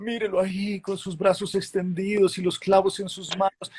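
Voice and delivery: manipulative preacher voice. Retold or imitated. Leaders describing Jesus on the cross, mildly mocked tearful-preacher tone. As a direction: coax tears out of the crowd, lightly mocked. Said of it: "esa voz que le quiere manipular a uno los sentimientos".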